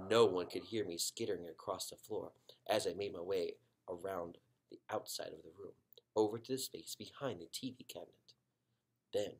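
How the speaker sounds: usual speaking voice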